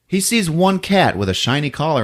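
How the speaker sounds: ordinary talk, nothing marked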